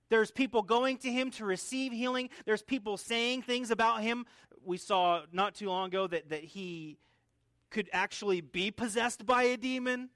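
ordinary delivery

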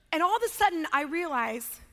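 ordinary delivery

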